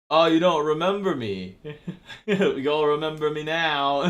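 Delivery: put-on a clumsy British accent, gloating at him; bad British accent. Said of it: "I can't do a British accent for shit".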